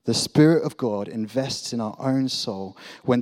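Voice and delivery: ordinary talk, nothing marked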